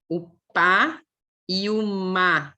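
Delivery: "pá" popped, "má" hummed, slowly; phonics demo. Shown as a demonstration. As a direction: contrast "o pá e o má" carefully. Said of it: "Agora, se eu digo". ("pá" explosivo e "má" nasal)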